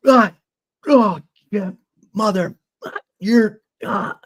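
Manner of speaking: high-pitched, broken bursts of cursing; mock cussing. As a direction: imitate an angry man sputtering half-swallowed curses. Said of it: "he was cussing me".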